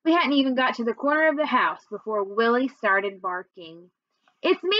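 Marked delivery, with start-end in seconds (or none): 4.4-4.8 high, lightly called announcement; mild high call-out. A narrator's reading. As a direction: call ahead to someone, pitched up. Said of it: "I called out".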